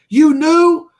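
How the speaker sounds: high, loud imitation of a stunned friend; retold yelp of shocked disbelief; mock shocked outburst. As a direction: blurt it in acted disbelief. Acting out friend's shocked reply.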